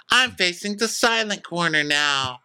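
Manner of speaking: high, prim mock announcement; mock Whitney. Whitney lightly mimicked as she announces her walk-off. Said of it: "such a Whitney walk off".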